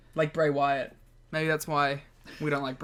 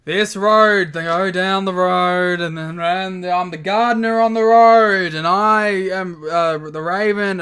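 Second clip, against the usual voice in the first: loud slow droning rant; bored grandiose rambling; mock Raven promo; 2 semitones higher, 8 dB louder than usual. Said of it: "sounds bored the whole time".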